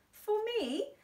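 high, touched and delighted; emotional. Acted. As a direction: squeal it, overcome. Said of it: "She became quite emotional".

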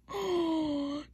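high delighted gasp. Real, not acted. Admiring her own nail, gasping in delight. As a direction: gasp as if you can't believe it.

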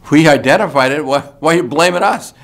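mock-indignant why blame us; indignant protest. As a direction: play the wronged party protesting loudly. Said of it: "the South Africans are saying".

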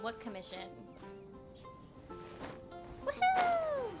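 ordinary delivery

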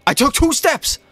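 a very high, indignant cry; outraged protest. Real, not acted. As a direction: plead your innocence to the ref. Protesting a charging penalty.

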